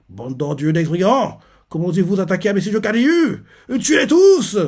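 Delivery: monte de plus en plus haut jusqu'au cri final; un lieutenant furieux qui ordonne le massacre; cri de rage. Retold or imitated. (cri de colère imité, de plus en plus aigu)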